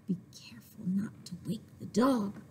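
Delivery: hushed whisper of a sneaking thief. A narrator's reading. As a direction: breathe it, don't voice it. Thieves sneaking at night.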